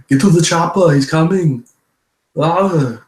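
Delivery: mock Austrian strongman urgency; Arnold. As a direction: shout it like an Austrian action star. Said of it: "I'm doing my Arnold voice".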